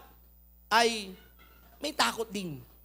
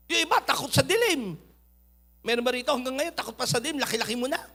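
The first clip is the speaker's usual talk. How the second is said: usual speaking voice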